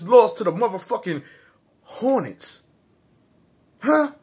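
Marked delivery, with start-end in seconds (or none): none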